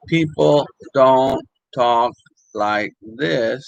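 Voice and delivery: slow, word by word, with gaps between; parole separate. Shown as a demonstration. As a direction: separate each word in "people don't talk like this". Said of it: "They don't separate the words".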